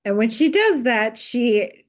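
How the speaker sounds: normal talking voice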